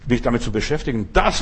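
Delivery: ordinary delivery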